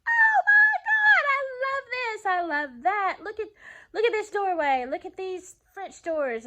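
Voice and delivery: high, loud, gushing squeals over the house; excited high squealing, giddy and loud; squealing with delight. Retold or imitated. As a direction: squeal like you just fell in love with a house. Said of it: "I was busy squealing".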